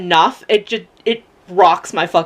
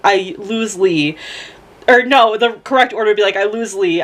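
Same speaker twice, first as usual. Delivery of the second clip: usual speaking voice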